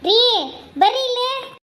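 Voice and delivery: high-pitched call to come here; calling over. Acted. Wife summons husband to a crying girl, calling out. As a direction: call someone across to you.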